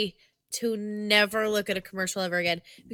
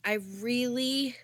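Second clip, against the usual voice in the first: ordinary talk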